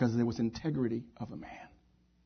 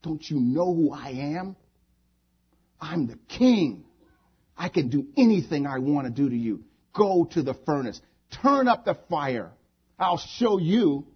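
furious king; 3 semitones higher, 6 dB louder than usual. Acted. King threatens those who would not bow, furious. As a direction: start restrained, swell into loud commands. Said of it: "He was furious".

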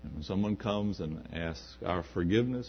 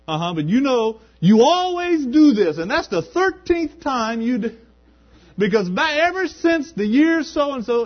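hammed-up grudge-holder listing old wrongs; shrill mock complaint over the thirteenth time; nagging reproach; 13 dB louder than usual. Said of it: "never bring it up again and say".

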